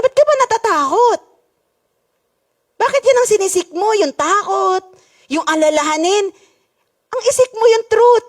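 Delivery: voice of the Lord. Retold or imitated. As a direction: speak as the Lord questioning her fear. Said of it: "sabi sa akin ng Panginoon".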